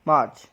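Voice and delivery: मार्च as "maach", r left out; silent R. Shown as a demonstration. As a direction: model मार्च with a long "aa" and no r. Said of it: "क्या बोलेंगे". (मार्च में आर silent, "माच")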